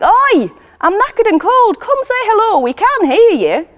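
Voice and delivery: loud, high shout up to the roof; tired but cheerful bellow upward; calling out. Acted.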